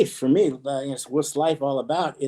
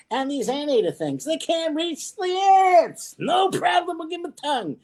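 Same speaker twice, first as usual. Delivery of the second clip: much higher, animated god voice handing out features; playful creator voice; 9 semitones higher than usual. Acted. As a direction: play the creator taking requests, bursting into shouts. Creator designing animals, playful skit.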